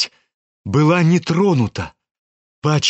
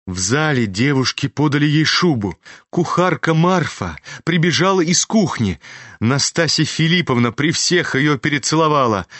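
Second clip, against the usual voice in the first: usual speaking voice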